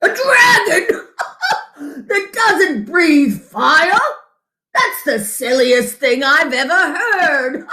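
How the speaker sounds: high voice, laughing through the words; mocking laugh. Father laughing at the son's harmless dragon friend, mocking laughter. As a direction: scoff at the idea with a big laugh.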